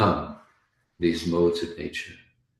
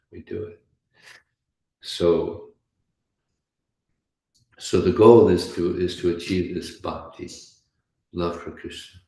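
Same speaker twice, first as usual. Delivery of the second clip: normal talking voice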